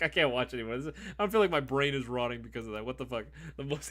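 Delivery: usual speaking voice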